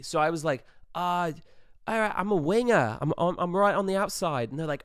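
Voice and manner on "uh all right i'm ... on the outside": higher, louder bluffing Australian; Australian accent. Acted. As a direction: bluff confidently in put-on Australian. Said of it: "committed to the Australian accent".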